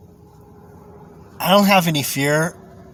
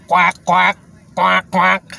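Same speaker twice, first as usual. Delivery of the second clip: mock duck quacking; duck quacks; 3 semitones higher, much slower than usual. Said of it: "It's a fucking duck".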